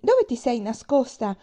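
high, loud, slow anxious calling. A narrator's reading. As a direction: search for her in alarm. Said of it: "chiese ansiosa".

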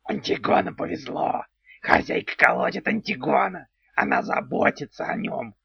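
delighted odd little goblin; gleeful goblin. A narrator's reading. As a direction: goblin thrilled at a beating. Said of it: "радостно хихикая, повторял".

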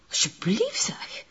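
breathless hushed whisper, almost no voice; whispered plea. Acted. Goedele urging Eva to stop doubting the master, whispered. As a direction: hush her, pleading under your breath. Hushing her doubting friend.

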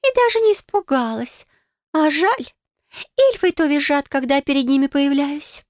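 high, sighing water-nymph voice; a bored, lonely mermaid sighing. Acted. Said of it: "вздохнула со словами".

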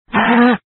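short scream of pain; burning scream. Acted. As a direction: cry out as the heat hits. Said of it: "tiếng kêu gào thảm thiết".

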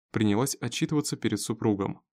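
plain reading voice

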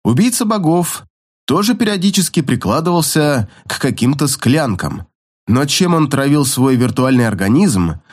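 ordinary delivery, nothing marked

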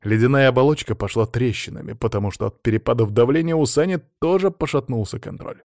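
ordinary delivery, nothing marked

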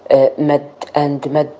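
ordinary talk

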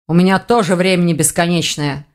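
plain reading voice

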